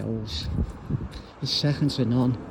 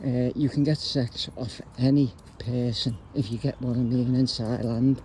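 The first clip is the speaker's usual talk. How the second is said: normal talking voice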